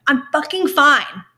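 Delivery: fed up with coworkers' sad eyes, exasperated shout; exasperated outburst. Pretend. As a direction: blurt it out, sick of the pity. Imagined outburst at pitying coworkers.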